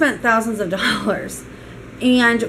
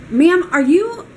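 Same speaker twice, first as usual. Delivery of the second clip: higher-pitched, curt rude questioning; retold rude demand, pitched up; rude maid; 5 semitones higher, 3 dB louder than usual. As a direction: imitate a rude hotel maid challenging a guest. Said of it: "and she's very rude".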